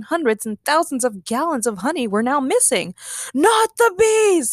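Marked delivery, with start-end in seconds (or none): 3.3-4.5 high, drawn-out cry of alarm; slow, loud, high-pitched wail; horrified shout. Acted. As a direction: shout in overdramatic dismay. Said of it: "Nick shouted".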